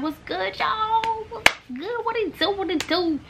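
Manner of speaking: very high, softened singsong hello; high-pitched greeting. Retold or imitated. Student greeting friends at lunch, high playful voice. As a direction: greet the gang in a tiny high voice.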